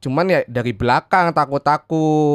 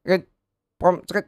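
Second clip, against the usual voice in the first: choppy phone line; 3 semitones higher than usual. Pretend. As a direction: voice cutting out like a bad signal. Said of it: "Putus-putus lagi".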